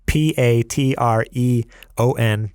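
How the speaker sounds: slow, letter by letter; spelled out. Shown as a demonstration. Listeners unsure of the spelling, spelled slowly. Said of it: "in case you don't know how to spell".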